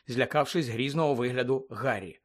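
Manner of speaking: ordinary delivery, nothing marked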